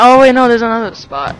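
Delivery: normal talking voice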